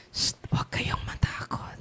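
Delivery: soft whisper after a shush; voiceless, calming whisper. Real, not acted. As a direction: hush them and whisper reassurance. Shushes and reassures congregation.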